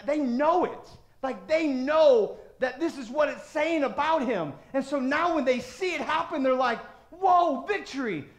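loud, driving preacher's voice; fiery pulpit delivery; full-force preaching. Acted. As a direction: pound each line home to the congregation.

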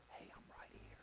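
soft whisper; still small voice. Acted. God's small voice answering the frustrated believer, whispered. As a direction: whisper it gently, like God close by. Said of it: "this still little small voice".

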